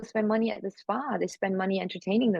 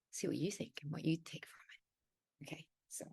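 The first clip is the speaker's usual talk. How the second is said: whisper; 2 semitones lower, 14 dB quieter than usual. Real, not acted. Guest whispers to host before reading the monologue. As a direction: drop to an unvoiced whisper.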